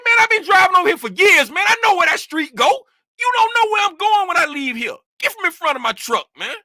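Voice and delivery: high, loud, rapid-fire indignant retelling; rowdy. Retold or imitated. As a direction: re-enact the argument, worked up and talking fast. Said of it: "I started getting rowdy".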